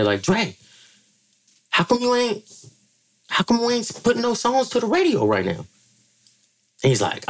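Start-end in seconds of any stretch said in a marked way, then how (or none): 0.2-5.6 much higher, eager question; imitating his younger self. Assistant editor asking [name] about radio, high eager voice. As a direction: ask it like a keen young fan. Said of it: "I just asked [name]".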